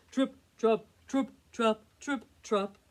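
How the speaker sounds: loud, punched hoofsteps; heavier tripping hooves. A narrator's reading. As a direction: stamp out each word crisply.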